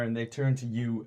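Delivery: ordinary delivery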